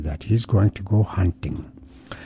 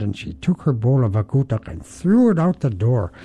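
plain reading voice

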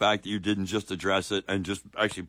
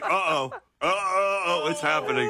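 higher-pitched, hammed-up whimpering uh-ohs; mock nervous panic; 7 semitones higher than usual. Host mimics the nervous questioner, mock panicky. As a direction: play a man in mounting panic, for laughs. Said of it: "He's even more nervous the second time".

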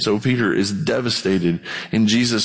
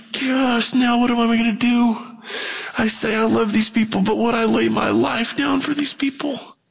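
much higher, flustered self-questioning; flustered inner voice; 10 semitones higher than usual. Retold or imitated. Pastor voicing his panic as people keep coming, much higher. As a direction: squeak it out like a man caught off guard. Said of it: "And I'm like".

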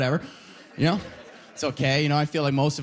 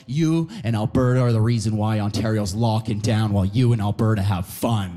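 low, accusing mock voice; mocking friends; 7 semitones lower than usual. Ontario friends blaming Alberta, low accusing mimicry. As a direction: drop low and blame him. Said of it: "They go".

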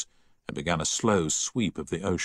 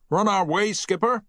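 engineer voice; 13 semitones higher, 4 dB louder than usual. A narrator's reading. Engineer answering through pipe.

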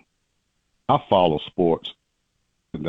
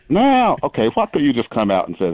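exasperated outburst; 5 semitones higher than usual. Real, not acted. Friend caught out, launching into a high exasperated "Now". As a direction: throw up your hands with a sharp high Now.

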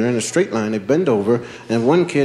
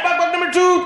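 much higher, louder; kid calling out; 3 dB louder than usual. Retold or imitated. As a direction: call the next jumper in. Kids calling the game.

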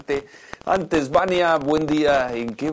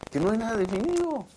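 high, softer incredulous reveal; mock disbelief; 6 semitones higher, 5 dB quieter, faster than usual. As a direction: let it out softly, high, in feigned amazement. Host mocks the vague announcement.

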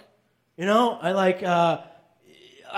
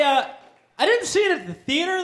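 ordinary talk, nothing marked

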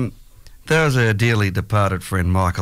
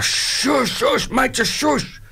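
mock yell of shush, pitched much higher; retold shushing yell; much slower than usual. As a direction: imitate him shushing you, high and insistent. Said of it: "I yelled at you".

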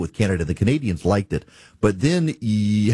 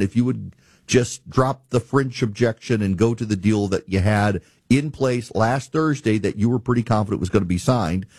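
usual speaking voice

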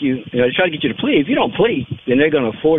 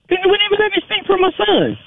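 upset high outburst. Real, not acted. Father angry about son's trial.

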